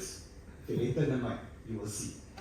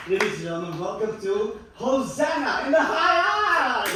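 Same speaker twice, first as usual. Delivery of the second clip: show announcer; 5 semitones higher, 5 dB louder than usual. Acted. Host announces the show title to the audience, shouted announcer style. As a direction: build to a loud high shout on the title.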